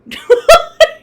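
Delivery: sudden high burst of laughter; real high-pitched laugh; loud laugh. Real, not acted.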